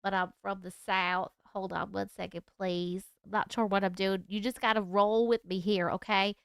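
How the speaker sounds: put-on nasally Southern accent. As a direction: put on a lazy Southern accent, like you have a cold. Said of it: "nasally Southern accent now".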